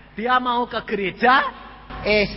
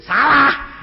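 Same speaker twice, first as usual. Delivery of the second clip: sharp, raised-voice correction; loud rebuke; 5 semitones higher, 6 dB louder than usual. Retold or imitated. Pastor correcting the husband, loud rebuke. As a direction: cut him off loudly, pitched up.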